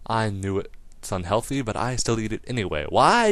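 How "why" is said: emphatic why. Acted. Rhetorical burst.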